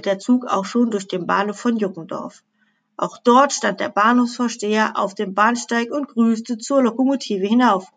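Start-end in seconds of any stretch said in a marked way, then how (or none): none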